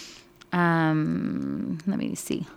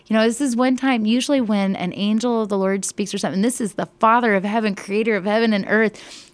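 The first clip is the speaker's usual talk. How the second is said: higher and louder, words tumbling out fast; excited amazement; 5 semitones higher, 4 dB louder than usual. Real, not acted. Teacher amazed the crowd didn't fall down, talking high, loud and quick. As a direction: marvel out loud, rushing with wonder. Teacher marvels at God speaking.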